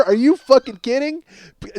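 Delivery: ordinary talk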